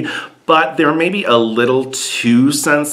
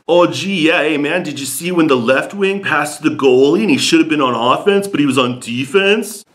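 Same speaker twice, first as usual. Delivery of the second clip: mock Canadian; 4 semitones higher than usual. Mocking Canadian hockey talk.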